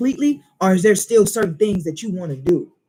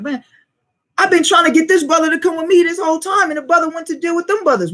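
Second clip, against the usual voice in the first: frustrated complaint; 6 semitones higher than usual. Retold or imitated. A brother griping about another brother who left, frustrated and raised. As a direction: vent that the brother went elsewhere. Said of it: "That was like".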